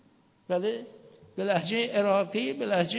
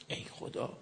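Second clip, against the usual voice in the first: whispered dismay; 12 dB quieter than usual. Real, not acted. Dismay at invalid ablution.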